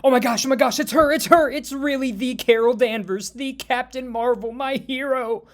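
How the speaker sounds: high, loud, starstruck squealing; frantic excitement, easing slightly but staying high; screaming fangirl. Acted. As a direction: gush at top pitch as if meeting your idol. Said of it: "begins jumping up and down and screaming".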